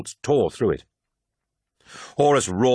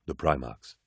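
low, hushed character voice; low, quiet statement; 7 semitones lower, 8 dB quieter than usual. A narrator's reading. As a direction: say it low and soft, falling away. One character names them, low.

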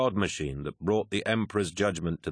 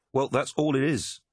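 sharp, higher retort; a quick irritated snap, pitched up; snapping; 8 semitones higher than usual. A narrator's reading. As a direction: bite back at him, irritated. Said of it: "snapped Arukin".

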